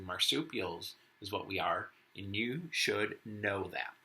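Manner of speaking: lively picture-book read-aloud as Warren the wombat; reading to children. Acted. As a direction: read it to the class with a touch of Aussie flair. Teacher reads picture book aloud.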